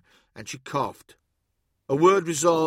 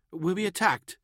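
higher, hesitant question; timid artificer; 5 semitones higher, faster than usual. A narrator's reading. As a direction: ask the warrior anxiously, in a higher voice. Anxious artificer questioning a warrior.

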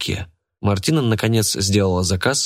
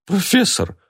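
frightened; 12 semitones higher, slower than usual. A narrator's reading. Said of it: "перепугалась Рогнеда".

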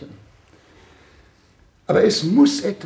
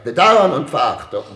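bitter outburst; 8 dB louder than usual. Acted. Excellency scolds his silent ministers.

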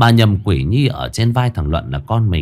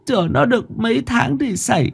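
tearful mother; 13 semitones higher than usual. Acted. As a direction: confess it gently, near tears. Mother confesses to lost child.

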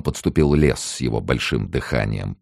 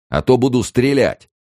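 loud, high shouted threat; rough yell; 11 semitones higher, 6 dB louder than usual. A narrator's reading. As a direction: threaten to fire at the top of a rough voice. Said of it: "заорал грубый голос".

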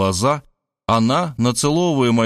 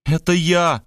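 higher-pitched, fervent cry; lightly theatrical outburst; inspired exclamation; 7 semitones higher, slower than usual. A narrator's reading. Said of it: "вдохновенно воскликнула она".

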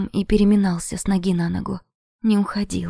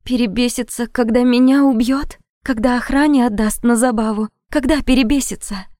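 higher, louder, rising anguish; bitter outburst; 4 semitones higher, 5 dB louder than usual. Acted. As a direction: hurl the questions back at him. Heroine bitterly protests in tears. (отчаянный крик)

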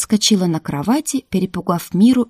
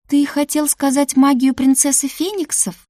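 higher-pitched, playful question; cheerful; 8 semitones higher, 3 dB louder than usual. A narrator's reading. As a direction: tease merrily in a lifted voice. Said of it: "весело подумала я". (весело, повыше)

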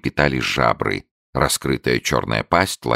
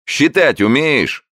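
high, loud and slow barker question; quizzing showman; 13 semitones higher, 4 dB louder, slower than usual. A narrator's reading. Showman quizzing his newt, high loud barker. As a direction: test the beast for the crowd.

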